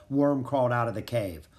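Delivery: plain reading voice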